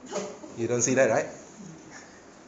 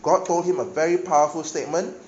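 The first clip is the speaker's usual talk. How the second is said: full-force preaching; 4 semitones higher, 7 dB louder than usual. Acted. Preacher drives Paul's verses home to congregation, loud preaching.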